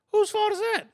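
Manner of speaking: high incredulous jab. Real, not acted. Rhetorical jab in a heated debate.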